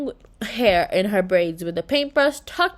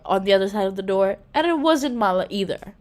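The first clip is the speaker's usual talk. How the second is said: ordinary delivery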